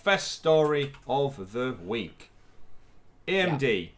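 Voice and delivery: stalling with long pauses between phrases; slowing down. Real, not acted. Host stalling over a wrong video, slowing right down. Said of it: "I'm slowing down 'cause I realised".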